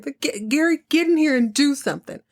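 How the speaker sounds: high-pitched urgent yell; hollering at the screen. Real, not acted. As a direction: shout at Gary to step in. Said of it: "I'm hollering at the show".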